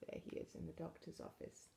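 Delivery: ordinary delivery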